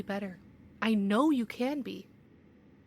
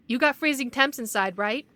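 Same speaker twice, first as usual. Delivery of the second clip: sharp demand; 2 semitones higher, 8 dB louder than usual. Acted. Official grilling the investigator.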